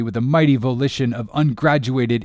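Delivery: ordinary delivery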